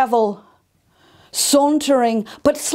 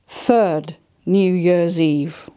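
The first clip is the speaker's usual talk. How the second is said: usual speaking voice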